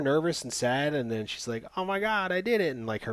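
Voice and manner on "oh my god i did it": high-pitched mock elation; mock girlish excitement. The girl who scored the game-winning goal, mock excitement. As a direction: imitate a thrilled girl in a raised voice.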